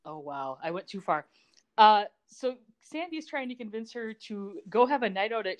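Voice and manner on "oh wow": ordinary delivery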